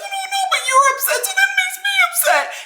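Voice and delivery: very high squeaky mock sob; fake crying. Host imitates Dorit suddenly trying to cry, fake crying. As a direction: whine it in a badly faked cry. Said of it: "like, this fake crying".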